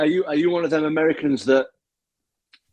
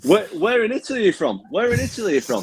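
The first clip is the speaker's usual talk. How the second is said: higher, hammed-up disbelieving question; mock quizzing; 8 semitones higher than usual. Tom quizzing the would-be Italian, mock disbelief. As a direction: push the question with mock surprise.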